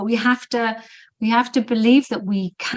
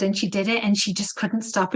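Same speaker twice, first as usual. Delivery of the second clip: normal talking voice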